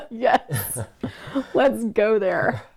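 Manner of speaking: high, slow words through laughter; laughing. Real, not acted. Partner amused by the topic of death, laughing. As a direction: giggle as you agree to go there.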